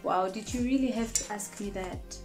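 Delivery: normal talking voice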